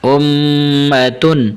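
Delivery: slow امتن with a measured hum on the m; level recitation, nasal m held briefly in امتن; measured ghunnah. Shown as a demonstration. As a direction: hum the meem in امتن without overdoing it. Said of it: "آپ نے زیادہ لمبا نہیں کرنا".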